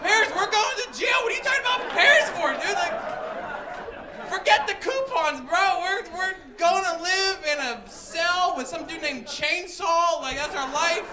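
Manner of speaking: high, loud, incredulous mock yelling; exasperated panic. Grandson facing jail over stolen phone, panicked yelling.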